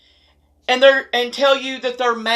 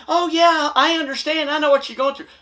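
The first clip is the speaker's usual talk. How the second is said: mock sympathetic husband; 6 semitones higher than usual. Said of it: "Don't sit there and say".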